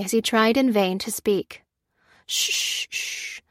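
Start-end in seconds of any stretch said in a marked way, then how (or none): 2.3-3.4 whispered hush to calm a patient; soft whispered shushing; soothing shush. A narrator's reading. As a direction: breathe out a gentle shh. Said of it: "placing a soothing arm".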